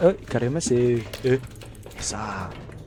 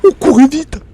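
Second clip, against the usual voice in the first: panicked shout; 12 semitones higher, 16 dB louder than usual. Real, not acted. Running panic.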